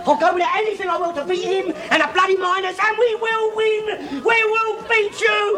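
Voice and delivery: a rising defiant shout that slows at the end. Real, not acted. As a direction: bellow it like a battle cry. Defiant rant against the miners.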